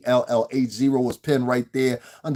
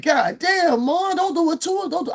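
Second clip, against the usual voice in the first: much higher, loud pleading cry; retold plea on the train; 4 dB louder than usual. Man on the train begging a woman with a knife, high pleading cry. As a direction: beg her to stop, voice jumping up. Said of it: "I said it just like that".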